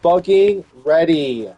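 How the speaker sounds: loud, high battle cry; rally yourself for war; ready to fight everyone, shouting; fired-up hype. Acted. Hyping up for war.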